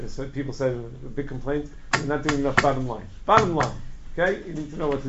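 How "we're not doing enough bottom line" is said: usual speaking voice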